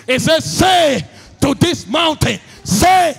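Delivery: loud, high, driving sermon voice; preaching at full force. Acted. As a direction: hammer each line at the crowd.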